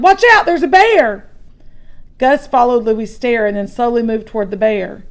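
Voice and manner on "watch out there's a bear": high, loud alarmed cry; urgent warning. Acted. Boy warns his brother about the bear. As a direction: shout a warning to save him. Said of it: "he shouted".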